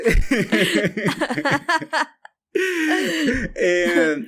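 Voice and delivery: high giggly laugh; laughing in delight. Real, not acted. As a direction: burst into a giggle. Laughing at memory.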